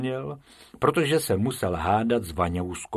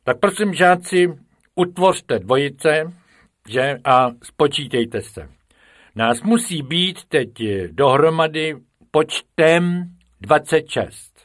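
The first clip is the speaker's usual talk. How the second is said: louder, higher teacher's commands; teacher, louder, lining up the pupils in pairs; ordering the class; 6 semitones higher, 7 dB louder than usual. A narrator's reading. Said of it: "nařizoval".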